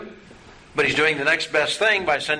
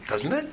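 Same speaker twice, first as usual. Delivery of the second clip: "Doesn't it?" softer, with a laugh and a rise on "it"; a chuckling demo of "Doesn't it?" going up; rising question tune; 6 dB quieter than usual. Shown as a demonstration. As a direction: laugh as "Doesn't it?" tips up at the end. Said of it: "It just went up".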